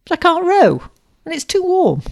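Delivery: very high, loud objection; dismayed protest. A narrator's reading. Suitor begs off rowing in the heat, protesting. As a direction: protest in a high, pleading wail.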